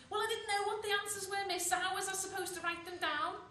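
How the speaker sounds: loud, high arguing back; high-pitched, affronted pupil backchat; indignant protest. Acted. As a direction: protest loudly to the teacher. Pupil argues back at teacher.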